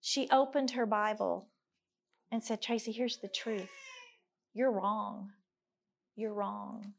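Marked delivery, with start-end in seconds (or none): 2.6-5.3 tearful quote. Retold or imitated. Retelling friend's rebuke, tearful and soft. Friend's hard truth, retold. 6.1-6.9 much softer, trailing. Retold or imitated. Friend's rebuke echoed softly.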